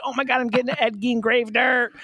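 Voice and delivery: high, giddy squeal. Retold or imitated. Host recalling getting grave dirt, giddy squeal. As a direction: squeal it like a delighted kid. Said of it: "I'm giddy".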